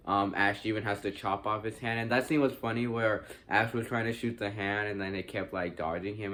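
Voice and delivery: usual speaking voice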